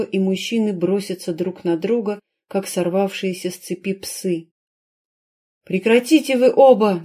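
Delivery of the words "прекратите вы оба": high, loud cry to stop; shouting. A narrator's reading. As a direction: yell at them to stop. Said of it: "закричала она".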